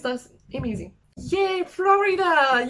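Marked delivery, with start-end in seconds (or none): 1.2-2.7 high, slow, loud little cheer; excited cheer. Real, not acted. Vlogger cheering for Florida, excited. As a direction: stretch out a happy yay.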